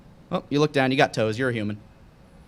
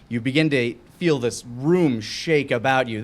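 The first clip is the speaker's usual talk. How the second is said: ordinary talk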